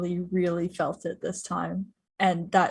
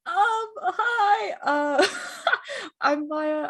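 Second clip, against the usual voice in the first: high, teary woo and hello; laugh-crying, barely holding it together; real tears while greeting the chat; crying through a cheer; 13 semitones higher, much slower than usual. Real, not acted. Cast member overcome after finale.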